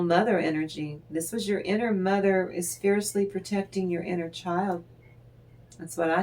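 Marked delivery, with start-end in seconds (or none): none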